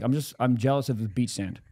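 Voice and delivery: ordinary delivery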